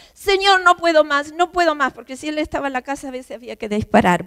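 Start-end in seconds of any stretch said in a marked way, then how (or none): 0.1-1.9 anguished cry to God. Retold or imitated. Crying out to God in despair.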